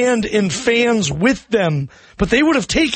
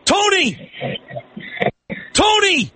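calling out; much slower than usual. Real, not acted. Host calling sleeping caller.

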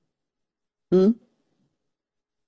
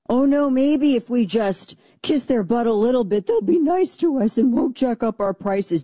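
higher-pitched, simpering mock worry; mocking appeasers; 5 semitones higher than usual. Host mimicking those who wanted us to be simps, mock simpering. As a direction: plead like a spineless people-pleaser, mockingly.

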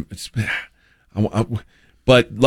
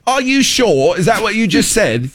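high-pitched, put-on British accent; exaggerated British questioning, very high; mock British accent; 11 semitones higher than usual. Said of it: "Trying to copy a British".

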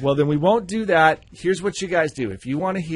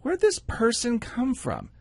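baffled; 8 semitones higher, 4 dB quieter, slower than usual. Retold or imitated. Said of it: "And I'm like".

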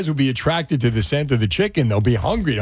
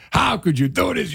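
mild mock yell, pitched higher; retold yelling; 6 semitones higher than usual. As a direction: act out yelling at dad without much volume.

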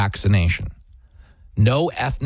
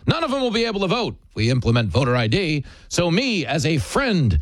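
higher-pitched parody of a patronizing self-appointed friend; mock condescending; 6 semitones higher than usual. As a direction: talk down to them as their supposed protector. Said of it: "You sound like you're talking down to 'em".

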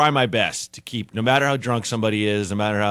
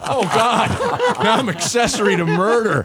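high, laughing mock dismay; 11 semitones higher, 3 dB louder than usual. Real, not acted. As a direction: laugh through the joke about being an accessory. Laughing aftermath.

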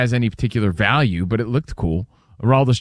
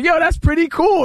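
excited; 13 semitones higher than usual. Retold or imitated.

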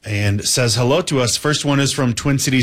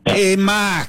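put-on high, bright Boston lady calling a kid; imitating a Boston landlady calling across the farm, Boston accent; 8 semitones higher than usual. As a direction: call out to little Mark in a Boston accent. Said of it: "She'd go".

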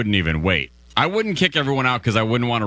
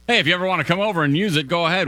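mock generous offer; 8 semitones higher, faster than usual. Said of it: "for real, like".

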